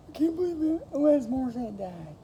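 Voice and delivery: high, loud fake disbelief; mock shock. Spreading a made-up death rumour to classmates, mock shocked and high. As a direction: sell the fake news with wide-eyed shock.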